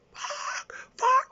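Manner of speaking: gasped then high yelped curse; imitating kid's shocked cry. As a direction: jolt out a panicked curse. Terrified kid after gun explodes.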